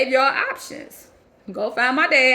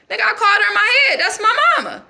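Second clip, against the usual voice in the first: loud, higher-pitched protest; indignant outburst; 8 semitones higher, 6 dB louder than usual. Acted. Girl defending calls to her mama, indignant and loud. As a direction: fire back indignantly, raised voice. Indignant retort to being questioned.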